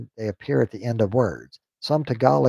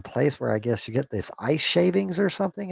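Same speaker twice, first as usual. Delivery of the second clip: usual speaking voice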